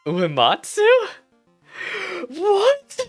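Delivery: normal talking voice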